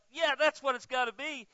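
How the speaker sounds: smug, knowing aside; imagining his own first confident reading; mock self-assured thought. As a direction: say it as if you're sure. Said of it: "I thought".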